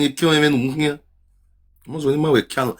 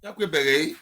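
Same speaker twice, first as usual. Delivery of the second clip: ordinary talk, nothing marked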